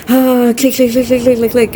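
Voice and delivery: a hint of a mocking gamer; mocking gamers clicking. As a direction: tap out the clicks like a rhythm-game player. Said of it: "all these gamers sitting there like".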